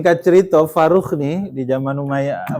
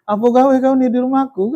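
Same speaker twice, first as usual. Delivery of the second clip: raised, higher angry challenge to an intruder; angry demand; 9 semitones higher than usual. Retold or imitated. Returning husband confronts young man in his house, angrily. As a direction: confront the stranger hotly, pitched up. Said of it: "Marah begitulah kepada anak muda ini".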